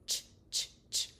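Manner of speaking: whispered "ch" squeezed tight. Shown as a demonstration. Therapist showing the squeeze for ch. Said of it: "I'm squeezing right in here".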